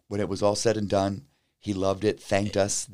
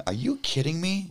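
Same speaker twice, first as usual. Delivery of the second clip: high, stunned are you kidding me; retelling his shock, pitch jumping up; stunned disbelief; 8 semitones higher than usual. Retold or imitated. Musician retelling meeting the president, high disbelief.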